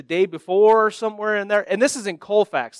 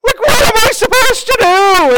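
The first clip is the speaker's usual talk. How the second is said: high, loud helpless panic; abandoned and frantic, squeal it out; panicked; 8 dB louder than usual. Retold or imitated. Left alone, panicking at birth.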